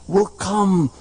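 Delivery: chanting, half-sung build; rising preached promise. Acted. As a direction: carry them up toward the promise.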